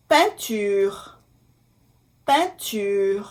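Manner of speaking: "peinture" in French, nasal "pein-", then "ture"; careful French pronunciation. Shown as a demonstration. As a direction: model "peinture" slowly, nasal first syllable. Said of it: "E I N".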